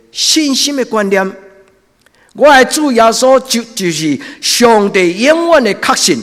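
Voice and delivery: loud, high, impassioned sermon; fiery preaching. Acted. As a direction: preach it out at full force. Preaching at full force.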